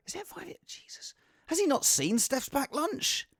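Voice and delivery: normal talking voice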